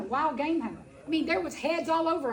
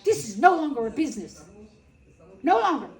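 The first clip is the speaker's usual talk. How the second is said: loud, firm, NO pressed; retold stand against her husband, louder; loud declaration; 6 dB louder than usual. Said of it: "I was like".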